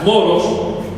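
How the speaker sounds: usual speaking voice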